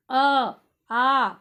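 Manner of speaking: a, aa voiced loudly from the throat; sounding out vowels. Shown as a demonstration. Teacher sounds out throat vowels for pupils. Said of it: "ये उच्चारण स्थान है".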